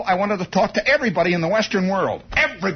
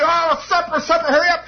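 retold mother's holler; 8 semitones higher than usual. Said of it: "My mother starts to holler".

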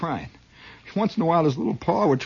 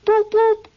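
two high, loud whistle toots; double whistle boop; 6 dB louder than usual. Retold or imitated. Lab men blow the whistle twice, high loud boops. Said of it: "Then they go".

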